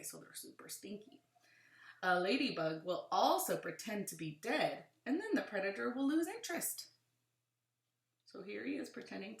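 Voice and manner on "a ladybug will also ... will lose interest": animated read-aloud with rising and falling emphasis; clear, lilting picture-book narration; reading to children. Acted. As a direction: read it to a class, punching key words.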